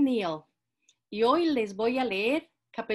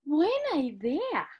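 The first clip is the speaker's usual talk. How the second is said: put-on little girl voice; 6 semitones higher, slower than usual. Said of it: "dijo Caperucita Roja".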